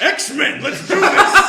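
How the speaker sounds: high, very loud hammed-up rally shout; mock-heroic team yell; rallying battle cry. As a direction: shout like a superhero leading the charge. Acting out team's battle cry.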